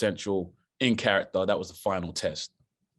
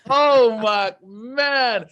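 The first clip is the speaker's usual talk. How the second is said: much higher, louder, overjoyed; excited; 13 semitones higher, 8 dB louder, much slower than usual. Retold or imitated. Actor reacting to the news in the pool, overjoyed. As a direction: burst with disbelieving joy. Celebrating getting the part.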